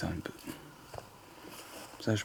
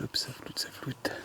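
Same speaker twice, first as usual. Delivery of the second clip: whispering; 4 semitones higher, 6 dB quieter than usual. Real, not acted. Camera blurs, hushed aside.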